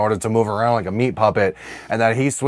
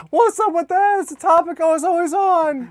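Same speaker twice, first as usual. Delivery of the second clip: yelling. Pretend. Mock yelling bit.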